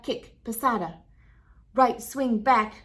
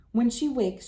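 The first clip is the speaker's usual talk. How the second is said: ordinary delivery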